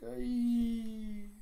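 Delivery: high-pitched, drawn-out screech; acted shriek. Creature shrieks before threatening Sophia, acted shriek.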